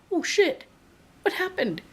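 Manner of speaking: alarmed surprise. Retold or imitated. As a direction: pitch jumps up on a startled curse. Car noise suddenly cranks up.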